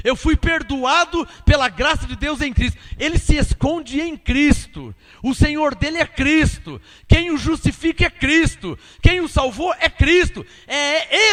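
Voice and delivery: preacher hammering out a list of assurances; emphatic preaching. Real, not acted. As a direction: drive each declaration home to the congregation. Preaches at full force.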